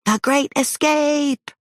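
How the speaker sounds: high, slow excited yell. Acted. Boy yelling while fleeing, high thrilled yell. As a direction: shout it like the title of a movie adventure. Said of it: "Oz yelled".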